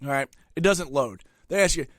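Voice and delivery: normal talking voice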